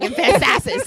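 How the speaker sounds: Emphasis said as ə̃nfæntæsɪs, high and loud; mispronounced emphasis. Real, not acted. Host laughing, fumbling the word emphasis. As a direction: trip over Emphasis with a laugh. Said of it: "I put the emphasis on the correct syllable".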